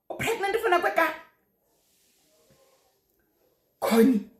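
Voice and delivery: high, loud scandalized scolding; scolding shout. Retold or imitated. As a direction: let her have it like a shocked grandmother. Grandmother scolding about late pregnancy news.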